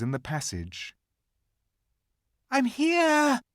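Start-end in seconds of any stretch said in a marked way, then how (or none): 2.5-3.4 put-on high, loud child's call. A narrator's reading. Lucy calls to her siblings, high and loud. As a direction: call out to the others down the passage. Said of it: "she shouted".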